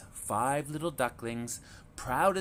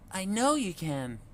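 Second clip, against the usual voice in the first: encouraging mother duck; 8 semitones higher than usual. A narrator's reading. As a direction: cheer the little ones on. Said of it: "Mama said".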